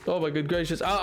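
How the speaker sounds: usual speaking voice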